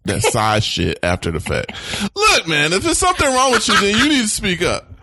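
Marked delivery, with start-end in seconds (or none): 2.2-4.9 exasperated rant. Real, not acted. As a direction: vent your frustration, pitched way up. Ranting at sighing partner.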